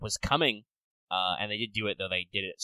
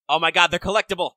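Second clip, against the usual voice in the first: loud, higher burst of excitement; excited exclamation; 7 semitones higher, 11 dB louder than usual. Real, not acted. Reacting to collectible stickers, loud and excited. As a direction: exclaim it loudly, pitch up.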